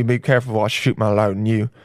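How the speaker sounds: ordinary delivery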